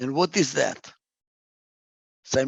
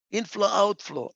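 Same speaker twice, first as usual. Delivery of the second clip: ordinary delivery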